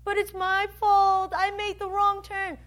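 acted guilty believer pleading; anxious protest. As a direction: raise the voice in worried protest. Acts worried believer answering the Father.

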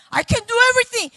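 high, loud, fast boast; mock independence. Woman boasts she manages everything alone, mock independence. Mimics self-sufficient woman, then helpless.

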